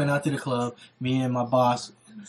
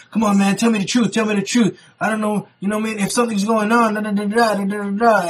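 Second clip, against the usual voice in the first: loud, higher-pitched mock complaining; bitching; 5 semitones higher, 4 dB louder than usual. Niece's boyfriend keeps pressing for truth, bitching. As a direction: imitate his nagging, ending in da-da filler. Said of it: "he's still bitching".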